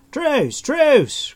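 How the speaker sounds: very high, loud cry; calling for truce. A narrator's reading. Stranger under attack begging Taran to stop, crying out. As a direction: cry out for mercy at the top of the voice. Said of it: "crawled the stranger".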